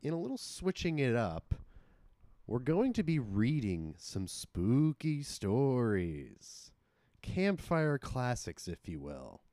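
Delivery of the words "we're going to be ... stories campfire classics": slow storyteller voice. Acted. Host slows for spooky intro.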